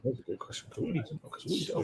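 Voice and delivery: hushed whisper aside. Real, not acted. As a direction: whisper it like a secret. Joking aside to co-host.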